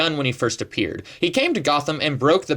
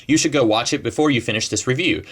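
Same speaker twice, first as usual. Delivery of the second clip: ordinary talk, nothing marked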